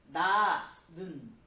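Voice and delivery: 나는 stretched very slowly and loudly; drawn-out example. Shown as a demonstration. Teacher stressing the example word for the class. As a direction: hold the word 나는 long and loud. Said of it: "도와주는 말이 뭐예요?".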